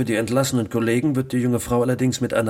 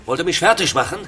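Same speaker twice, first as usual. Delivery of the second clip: shouting in outrage, much higher and louder; furious outburst; 10 semitones higher, 8 dB louder than usual. Real, not acted. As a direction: blow up at the news. Boss furious at news report.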